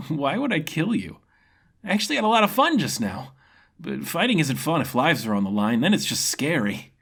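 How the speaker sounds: a carefree fighter enjoying the duel; grinning swordsman impressed by his foe; cheerful young fighter. Acted. Ren refuses to kill.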